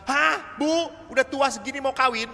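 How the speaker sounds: high, loud incredulous question; surprised priest. Retold or imitated. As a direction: scoff in disbelief at the old mother. Priest mocking mother at registration. (pastor heran, suara tinggi dan keras)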